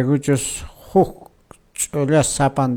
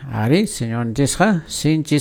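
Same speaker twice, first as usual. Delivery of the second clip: ordinary talk, nothing marked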